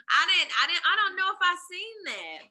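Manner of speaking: normal talking voice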